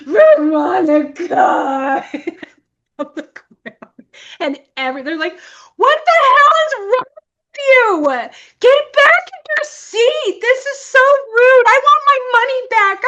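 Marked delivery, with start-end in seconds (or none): 0.0-2.0 long, slow, hammed-up wail; mock scream. Re-enacting collapse on the theater floor, drawn-out mock scream. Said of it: "Just scream". 5.8-13.0 high, loud mock-outraged yelling; outraged crowd. Angry audience scolding the woman disrupting the film, mock-outraged yell.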